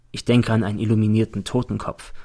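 plain reading voice

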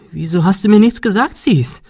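high, loud, agitated outburst; voice cracking with agitation. A narrator's reading. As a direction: voice tipping over in upset. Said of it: "Ihre Stimme überschlug sich vor Aufregung". (sich überschlagende Stimme vor Aufregung)

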